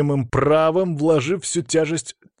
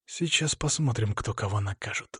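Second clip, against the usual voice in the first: through gritted teeth; 2 semitones lower, 7 dB quieter than usual. A narrator's reading. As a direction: toss out a soft, tight-jawed warning. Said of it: "сквозь зубы бросил Сергей".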